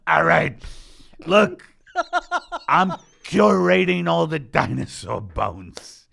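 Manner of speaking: loud, high, bellowed workman act; blue-collar worker. Acted. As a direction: holler it like a foreman on a job site. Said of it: "a blue collar type job".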